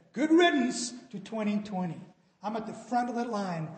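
an impatient crowd glad to see it go; eager cheer. Retold or imitated. Preacher voicing people cheering out the old year, loud and eager.

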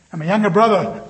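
ordinary talk, nothing marked

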